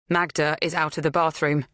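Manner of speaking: plain reading voice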